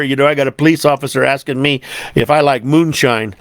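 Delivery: ordinary talk, nothing marked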